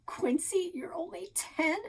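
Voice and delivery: high, incredulous question; surprised teasing. A narrator's reading. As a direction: gasp it in mock disbelief. Surprised laughing girl.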